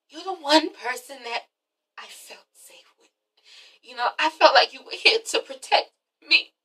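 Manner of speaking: quiet, half-whispered confession; soft hurt voice. Retold or imitated. Kenya telling Matt she felt safe, soft. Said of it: "And she's like".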